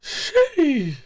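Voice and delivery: squealed high sheesh. Acted. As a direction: stretch it out, way up high. Hype exclamation after bit.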